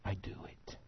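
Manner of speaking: very soft, almost whispered; quiet, humble surrender; hushed submission. Acted. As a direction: drop right down to a near-whisper. Preacher voices humble obedience.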